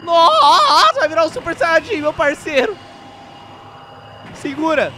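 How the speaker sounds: high, loud hype; excited shout. Real, not acted. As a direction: call out the transformation like a fan. Reagindo à transformação. (grito animado de fã)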